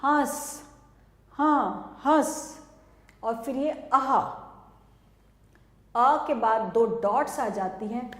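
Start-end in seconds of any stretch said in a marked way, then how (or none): 1.3-2.6 हंस drawn out slowly with nasal अं; slow demo word. Shown as a demonstration. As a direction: sound out हंस slowly, nasal vowel held. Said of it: "जैसे कि".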